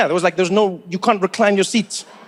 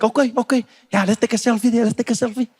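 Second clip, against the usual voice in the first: eager South African voice pitched a bit higher; South African seatmate; 3 semitones higher than usual. Retold or imitated. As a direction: play the keen local guy asking for a selfie.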